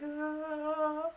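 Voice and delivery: long, high, soft mock wail; hammed-up thin cry, held on one note; mock whine. Telling how they felt bruised in the face, mock whine.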